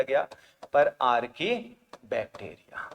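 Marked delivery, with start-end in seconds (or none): none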